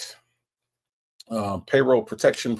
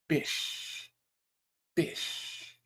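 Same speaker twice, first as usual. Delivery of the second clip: breathy whispered hiss of the word; whispered bish; 4 semitones higher, 12 dB quieter than usual. Pretend. Reading a chatter's greeting aloud as a sly whisper.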